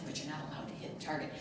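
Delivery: very soft, low, patient insistence; gentle. Retold or imitated. Shopper repeating the rule to a five-year-old, gentle hushed firmness. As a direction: keep it hushed and kindly firm. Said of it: "I just gently said".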